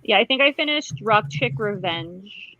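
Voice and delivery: normal talking voice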